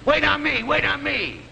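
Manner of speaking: high-pitched imitation of clamoring women; high mock yelling, crowd of impatient customers; mock screaming customers. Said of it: "They're all screaming and yelling".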